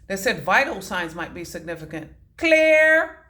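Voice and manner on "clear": loud call of clear before the shock; shouting clear. Retold or imitated. Nurse calling clear over a patient, loud and high. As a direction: call it out sharp and high like a code team. Retold defibrillator call in hospital.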